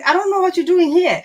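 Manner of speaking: exasperated therapist. Retold or imitated. Therapist telling a client she can't help, exasperated. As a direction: let her sound fed up with the client. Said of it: "she was just like".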